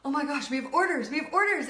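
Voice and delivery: hearing she finally has orders, excited; excited disbelief. Retold or imitated. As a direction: blurt it out, can't believe it. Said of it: "I'm like".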